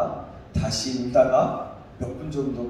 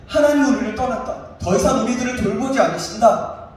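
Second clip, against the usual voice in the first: raised voice of a grumbling crowd; loud complaining; 6 semitones higher, 6 dB louder than usual. Acted. Preacher voicing the Israelites' grumbling against God, loud complaint. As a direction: complain loudly, pitched high. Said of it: "뭐라고 불평하냐면".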